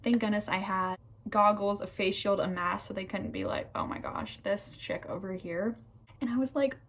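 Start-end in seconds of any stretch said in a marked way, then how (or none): 3.7-5.8 whispered, imitating staff gossiping about her; a hushed, teasing aside; mock gossip whisper. Student imagining staff mocking her tears, in a mock whisper.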